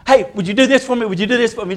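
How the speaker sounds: hyper Tom. Retold or imitated. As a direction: bounce it out like an overeager kid. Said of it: "kind of a hyper".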